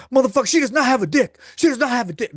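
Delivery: high, loud, fast yelled denial; imitated screaming. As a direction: scream it at the chat. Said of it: "he'll start screaming".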